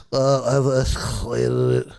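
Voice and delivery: lightly voiced weary agent; bored agent voice. Bored support agent stalling the customer, lightly mocked. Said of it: "that's how I feel like Justin would talk".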